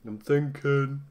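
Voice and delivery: a half-hearted stab at a pondering statue; mock thinking statue. Streamer imitating the thinking statue from Night at the Museum, lightly musing. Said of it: "like I'm yawning".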